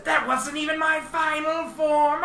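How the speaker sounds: high, hammed-up villain bellow; villain boast. GM voicing the alien boss bragging as he transforms. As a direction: shout it like a cartoon villain powering up. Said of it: "he says".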